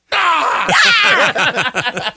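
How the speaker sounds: high thrilled squeal with a laugh; thrilled shriek. Retold or imitated. Fan squealing at his hero's line. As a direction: shriek with delight at hearing it. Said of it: "And I was like".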